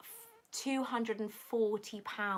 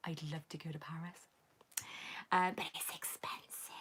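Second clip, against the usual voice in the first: almost whispered, much softer; hushed wistful aside; 4 semitones lower, 8 dB quieter than usual. Real, not acted. Streamer reads a Paris viewer's message, hushed and wistful. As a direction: breathe it out like a private wish.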